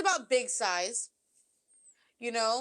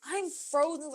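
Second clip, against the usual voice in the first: normal talking voice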